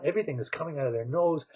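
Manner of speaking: plain reading voice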